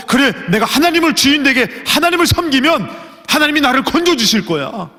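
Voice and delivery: high, bright vow with a laugh in it; joyful resolve. Acted. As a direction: declare it with happy conviction. Acted young believer's resolve. (웃음 섞인 높고 신난 결단)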